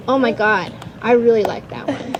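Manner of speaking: normal talking voice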